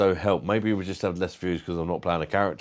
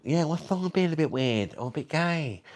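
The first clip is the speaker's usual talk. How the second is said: much higher, mock-affronted camp voice; camp protest; 9 semitones higher than usual.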